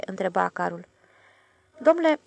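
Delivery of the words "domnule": higher, hesitant embarrassed address; awkward, unsure how to begin; sheepish opening word. A narrator's reading. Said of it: "zise încurcat domnul Fotiade".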